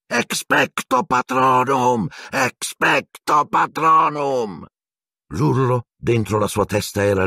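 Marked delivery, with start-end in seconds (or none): 0.0-4.7 high, slow, strained incantations; Harry struggling against the dementor, repeated crying out; desperate repeated spell. A narrator's reading. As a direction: keep crying the spell as it fades.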